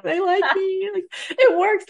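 high, choked-up voice of happy tears; retold tearful joy, pitched high; teary disbelief. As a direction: voice catching as if near tears. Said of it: "like, crying, like".

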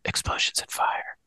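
breathy dramatic whisper. Acted. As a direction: hiss the title like a secret. Show name whispered in intro.

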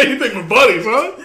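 laughing sergeant challenge, rising on "huh"; mock accusing. Sergeant to over-familiar recruit, mock accusation. Co-host echoes sergeant's taunt.

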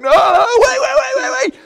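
high, loud mock scream; hammed-up pleading shriek; panicked shouting. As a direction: yelp it rapidly as if in sudden pain. Retold panic at painful pull.

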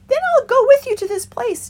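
high, loud doubtful rabbit; young rabbit. A narrator's reading. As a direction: ask it high and wary. Fickle decides.

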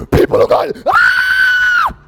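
huge, high thunderous cry; booming shout. Acted. God thundering at the people, a huge shout. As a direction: blast it like thunder from the mountain.

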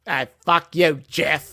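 loud, crude mock Australian; rude, botched Aussie outburst; foul-mouthed Tony Storm. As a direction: curse him out in a bad Australian accent. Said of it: "very foul-mouthed".

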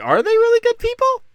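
high, loud incredulous question. Retold or imitated. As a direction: pitch it way up in mock disbelief. People questioning the official history.

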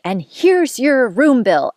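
slow, high, pointed delivery; spiteful retort. Retold or imitated. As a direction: spell it out with scorn. Throwing bill at date in anger.

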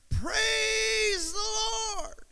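high, slow drawn-out cry of praise; old lady's joyful shout. A narrator's reading. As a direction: stretch it out like a happy old saint calling to heaven. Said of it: "she would yell out".

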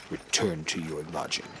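very low, rumbling guard voice. Acted. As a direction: rumble it up from the boots. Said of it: "with a voice that rumbles".

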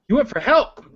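high-pitched disbelieving echo; incredulous. Acted. Character questions companion who fled, incredulous. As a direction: throw it back in disbelief.

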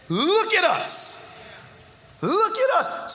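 high, slow commanding call of Peter; commanding demand. Acted. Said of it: "They demanded. There's an exclamation point".

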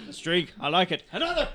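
loud, high rowdy shout; boisterous demand. Acted. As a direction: slam the table for another. Character demands another drink.

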